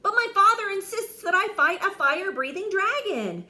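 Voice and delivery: high, loud whining complaint; whiny knight moaning. Acted. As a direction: moan about his father. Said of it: "Sir George moaned".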